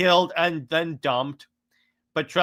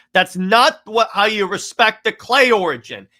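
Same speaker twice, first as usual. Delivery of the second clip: higher, louder heated objection; indignant rant; 8 semitones higher, 8 dB louder than usual. Real, not acted. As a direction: protest it hotly, pitched up and loud. Indignant rant about the retcon.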